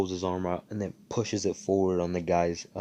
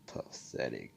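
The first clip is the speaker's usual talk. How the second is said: low, much softer scornful dismissal; contemptuous; 8 semitones lower, 12 dB quieter than usual. Retold or imitated. Asta watching the fleeing thief, low scornful aside. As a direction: drop it low, dripping disdain. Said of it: "and he's like".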